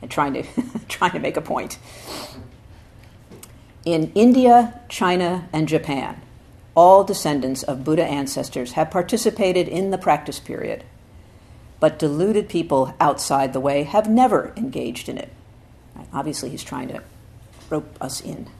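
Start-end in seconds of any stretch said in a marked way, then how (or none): 3.8-15.3 louder, emphatic recitation, rising at the start; solemn scripture reading. Acted. Teacher reading Dogen's essay to students, emphatic. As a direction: proclaim the old text with weight.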